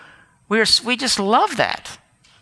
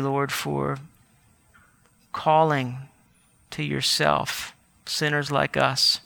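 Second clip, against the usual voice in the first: soft, humble prayer voice; a pastor gently leading the church in prayer; closing prayer; 4 semitones lower, 4 dB quieter than usual. Acted.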